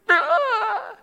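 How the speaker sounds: put-on high sudden yelp like a startled crying kid; kid's mock wail. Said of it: "they just start crying".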